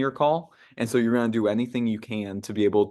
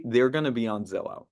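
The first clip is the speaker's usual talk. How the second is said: normal talking voice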